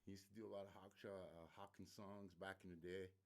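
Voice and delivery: normal talking voice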